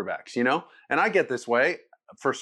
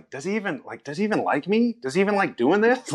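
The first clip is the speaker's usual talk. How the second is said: higher, unsure questions rising at the end; lightly voiced insecure player rising higher; doubting inner voice; 8 semitones higher, faster than usual. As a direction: wonder aloud if he even likes you. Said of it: "you're just like".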